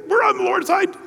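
very high, squeaky eunuch voice. Acted. Two eunuchs answering Jehu out the window, high eunuch voice. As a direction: pipe up in a comic high-pitched voice.

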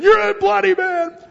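mock yell. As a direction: shriek the insult at David in a scraggly old man's voice. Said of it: "starts throwing them at David, saying".

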